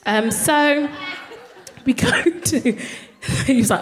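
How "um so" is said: normal talking voice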